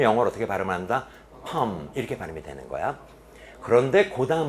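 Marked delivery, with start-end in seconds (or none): none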